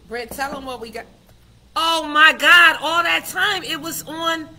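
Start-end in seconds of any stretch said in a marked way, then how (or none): 1.7-4.5 much higher and much louder, slowing on oh my God; real shocked realization. Real, not acted. Host realizing her live stream was silent all along, loud shocked outburst. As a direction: blurt it out in sudden dismay.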